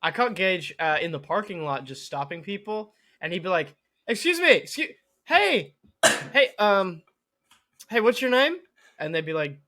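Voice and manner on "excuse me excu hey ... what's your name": loud, high mock call; raised-voice attention-getting; flagging down strangers. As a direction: imitate someone eagerly hailing a passerby. Acts out stopping strangers in lot.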